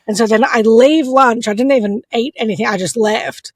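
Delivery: normal talking voice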